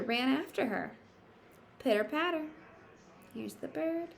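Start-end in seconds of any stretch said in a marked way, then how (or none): 1.8-2.5 high, bouncy sound word; pattering footsteps. Acted. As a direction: skip it out lightly. Sound word in picture book.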